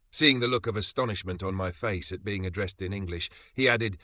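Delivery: ordinary delivery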